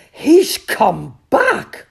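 slow, high, amazed shout. A narrator's reading. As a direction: cry out the astonishing news. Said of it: "shouted Badger".

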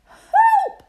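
high, loud cry for help; frightened cry. Acted. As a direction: shriek it in sudden fright. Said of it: "cried the animals".

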